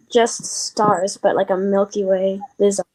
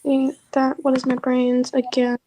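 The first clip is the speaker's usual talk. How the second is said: ordinary talk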